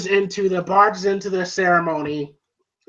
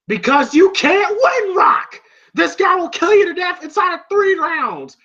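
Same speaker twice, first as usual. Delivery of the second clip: high mock shout; Mickey; 12 semitones higher, 3 dB louder than usual. Trainer warning Rocky he can't win, shouted imitation. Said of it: "And then Mickey goes".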